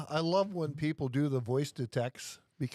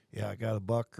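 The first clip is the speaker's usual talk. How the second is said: lower, lightly voiced hunter; casual hunter; 8 semitones lower than usual. Imitating someone bragging about trail camera deer, low casual hunter. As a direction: drop the pitch for the quote.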